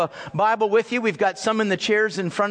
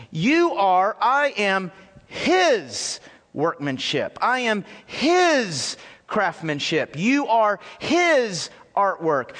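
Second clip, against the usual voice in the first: His leaned on, slow and deliberate; "I am His workmanship" with His weighted and drawn out; stress on His; slower than usual. Shown as a demonstration. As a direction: hit the word His every time, slowly. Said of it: "the emphasis is on the word His".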